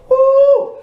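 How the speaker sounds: high loud whoop; joyful preacher's woo; excited whoop. Real, not acted. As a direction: let out a whoop of delight. Preacher exclaims after quote.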